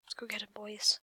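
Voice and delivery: unvoiced excited whisper. Real, not acted. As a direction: hiss it to your crew. Sneaky chase whisper.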